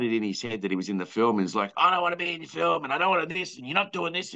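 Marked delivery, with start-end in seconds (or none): 1.8-4.3 imitating a huffy actor, pitched higher; mock-whiny protest, pitched up. Director mimicking the actor who demanded out of the film, pitched-up whine.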